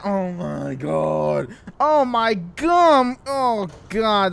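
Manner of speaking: slow, stretched groaning words; drawn-out lament. Acted. Soaked friend finds the gum all yucky, lamenting. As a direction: groan over every ruined thing.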